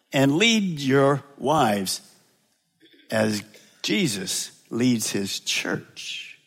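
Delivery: slow down and sink into it; slow and solemn. Real, not acted. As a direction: lead your wives said slowly, dropping soft. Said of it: "slow solemn charge".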